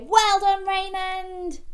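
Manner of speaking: high, loud well done; big high cheer for the children to join; cheering praise. Shown as a demonstration. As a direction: cheer him on for the kids to copy. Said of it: "give a thumbs up to Raymond and say".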